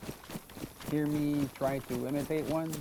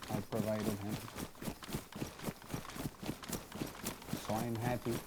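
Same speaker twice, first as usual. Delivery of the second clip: a lone survivor thinking aloud, quiet and lower; in-character survivor; 3 semitones lower, 8 dB quieter than usual. Acted. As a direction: muse to yourself while trekking alone, subdued.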